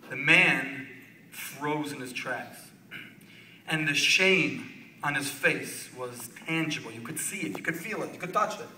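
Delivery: soft, low narration of a solemn moment; hushed storytelling. A narrator's reading. Telling of a man frozen in shame, hushed storytelling. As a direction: drop the voice and let the moment hang.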